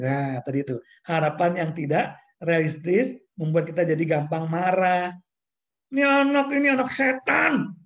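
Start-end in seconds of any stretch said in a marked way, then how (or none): 5.9-7.8 put-on high, loud scolding of a naughty child; furious mother cursing her child; angry scolding parent. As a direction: snap at the kid in raised anger. Parent scolding child, acted quarrel.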